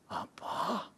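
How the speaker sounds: breathless whispered what; stunned whispered apa; shocked whisper. Retold or imitated. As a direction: gasp it out in disbelief. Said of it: "dengan mendelik".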